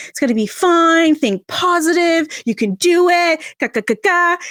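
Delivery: hollow cheerleader pep talk, hammed up; mock cheerful platitudes. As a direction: chirp the clichés back in a mocking tone. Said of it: "what all the other people were saying".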